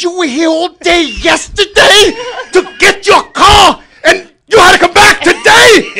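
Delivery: loud, slow, disbelieving shout; exasperated yell. Retold or imitated. To the towing guy, slow furious shout.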